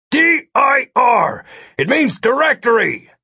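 high, loud, spelled-out shout. A narrator's reading. Agent spelling a command for his partner, shouting. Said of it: "Miller shouted".

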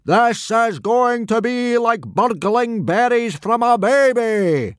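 high, loud and slow booming boast; big Viking boasting to the boys; booming Gobber. A narrator's reading. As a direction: boom it out, savouring each word. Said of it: "boomed Gobber the Belch".

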